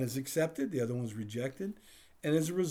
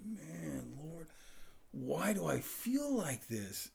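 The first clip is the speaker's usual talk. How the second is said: soft, slow groan to the Lord; frustrated prayer; 4 semitones higher, 7 dB quieter, slower than usual. Retold or imitated. Frustrated man praying alone outside, soft and slow. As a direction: murmur it up in frustration. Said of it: "I'm like".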